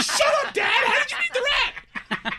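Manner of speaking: put-on very high, loud kid shout; shouting excited kid. Kids amazed that dad met the rat, in a high shouting kid voice. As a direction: scream it as an amazed child.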